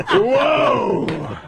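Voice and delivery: high, drawn-out mock-amazed whoa; sportscaster whoa. Comic re-enacting his fake sports highlights, amazed sportscaster. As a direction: play an astonished highlight announcer.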